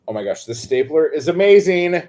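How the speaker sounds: loud, high gushing, building to the end; overjoyed. Real, not acted. Crafter admiring the new stapler, loudly overjoyed. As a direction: gush with full excitement.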